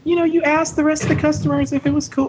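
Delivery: manager impression. Store manager lets him record the podcast, in high impression voice. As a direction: squeak out a hammed-up female manager. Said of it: "that's my best manager impression".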